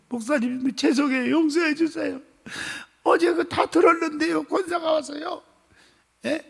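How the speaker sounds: high, sobbing plea for forgiveness; tearful apology. Retold or imitated. Deacon tearfully apologizing to the pastor, crying high. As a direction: weep out the apology, voice breaking high. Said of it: "하고 펑펑 우는 거야".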